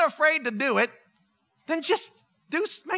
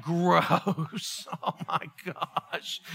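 low, slow groaning disgust; mock disgusted dread; 6 semitones lower, 5 dB quieter, much slower than usual. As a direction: groan it out, dragging the words. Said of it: "And I was like".

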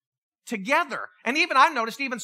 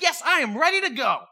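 higher-pitched, triumphant readiness; excited declaration, pitched up; eager; 6 semitones higher, 4 dB louder than usual. Acted. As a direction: act out fired-up eagerness. Jesus eager after receiving Spirit.

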